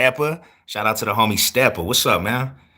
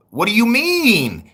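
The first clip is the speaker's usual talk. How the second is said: indignant surprise; 12 semitones higher, slower than usual. Real, not acted. Indignant at "hear yourself talk.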